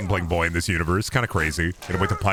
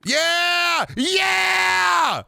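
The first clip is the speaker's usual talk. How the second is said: very high, drawn-out shout; triumphant cheer. Real, not acted. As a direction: shriek it out in triumph. Celebrates landing the pie.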